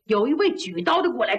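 ordinary delivery

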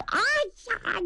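high hammed-up cry of dismay; mock alarm. The marshal pretending to lose the duel, hammed-up high yelp. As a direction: fake a startled yelp.

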